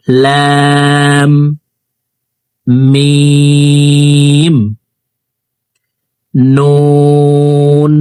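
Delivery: letters held slow, মিম sung; recited letters. Shown as a demonstration. Teacher repeats the letters for students, slow recitation. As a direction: chant the letters one by one, slow. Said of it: "আমি এখান থেকে আবার বলছি".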